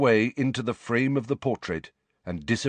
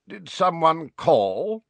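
put-on drowsy old wizard; 9 semitones higher, 3 dB louder, slower than usual. A narrator's reading. Feigning waking up.

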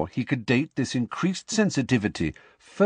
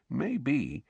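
softer, conspiratorial; lower voice; 4 semitones higher, 7 dB quieter than usual. Acted. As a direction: drop the voice to share a secret. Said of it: "in a lower voice".

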